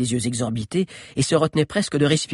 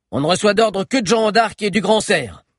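higher, louder proud retort; proud defiance; 9 semitones higher, 5 dB louder than usual. A narrator's reading. Boy guard defying the giant, proudly. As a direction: stand tall and defy. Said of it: "lança-t-il en se redressant fièrement".

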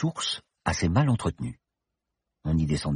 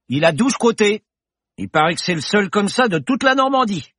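bar regular's voice; 14 semitones higher, 5 dB louder than usual. Acted.

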